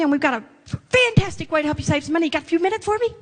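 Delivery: high, eager, rushed sales pitch that barges in; pushy salesperson. Retold or imitated. Saleswoman ambushing a manager at the center, high and rushed. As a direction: gush the whole pitch fast without taking a breath. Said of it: "and they run up to you and they say".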